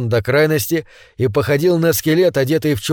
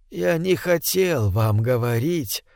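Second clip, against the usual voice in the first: almost tender; 5 dB quieter, slower than usual. Acted. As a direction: speak gently, almost tenderly. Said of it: "и голос был почти нежным".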